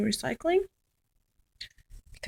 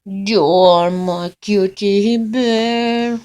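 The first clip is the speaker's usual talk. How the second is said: slow cooing; 8 dB louder, much slower than usual. Real, not acted. Cooing at the dog.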